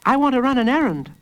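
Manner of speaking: put-on high, quick child voice; fast, high-pitched kid's plea; eager little boy. A narrator's reading. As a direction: pester father as a small boy. Small boy repeats plea.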